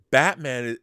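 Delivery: ordinary talk, nothing marked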